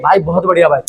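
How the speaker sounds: ordinary talk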